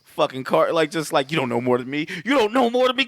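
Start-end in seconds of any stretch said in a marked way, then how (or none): 2.2-3.1 higher, heated repeat of the line; raised-pitch pretend rage; mock angry outburst. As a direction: snap it as a hammed-up attacker. Acted killer line repeated, intensified.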